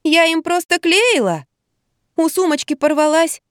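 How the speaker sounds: high-pitched self-justification; plaintive protest. A narrator's reading. Patient protests false accusation. (оправдываться высоким голосом)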